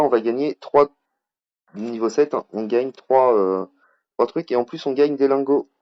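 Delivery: ordinary delivery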